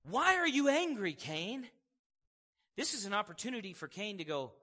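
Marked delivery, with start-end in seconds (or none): 0.0-1.7 loud, high confronting question; acted forceful questioning, easing off on the name; firm challenge. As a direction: call him out, punching the opening. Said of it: "That's not what God's doing here".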